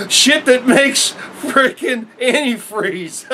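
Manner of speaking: slow, laughing disbelief; can't hold back the laughs at how absurd it is; laughing through it. Real, not acted. Telling viewers about junk food, laughing slowly.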